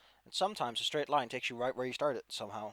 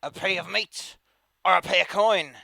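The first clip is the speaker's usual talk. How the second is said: bandit leader voice; 2 semitones higher, 10 dB louder than usual. Acted. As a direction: bark out a toll like a proud brute.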